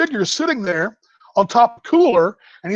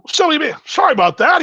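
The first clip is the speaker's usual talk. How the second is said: high, louder put-on apology; offhand sorry in a raised character voice; Lou Piniella; 8 semitones higher, 4 dB louder than usual. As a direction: imitate an old ballplayer, pitched up. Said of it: "It's Lou Piniella".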